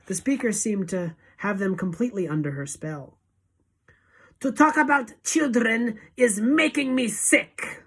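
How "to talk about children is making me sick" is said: high, loud screamed rant; Grand High Witch scream. A narrator's reading. Witch leader sickened by children, high loud scream. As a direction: scream it in revulsion. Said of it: "screamed the Grand High Witch".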